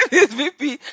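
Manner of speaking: high, loud, laughing through it; real laughter breaking the words. Real, not acted. Teller cracking up at the fake vice president, laughing.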